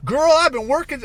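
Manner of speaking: high, loud mock girl-talk; Guerrilla Harris impression. Answering the talk show host, mocking high voice. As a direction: exaggerate a chummy, high-pitched interviewee. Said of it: "she's talking like this".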